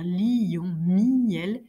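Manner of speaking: « lion » et « miel » étirés en deux syllabes, li-on, mi-el; exagérer la coupure dans « lion » puis « miel »; diérèse exagérée. Shown as a demonstration. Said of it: "je peux exagérer la distinction". (« lion » et « miel » coupés en deux, comme une diérèse forcée)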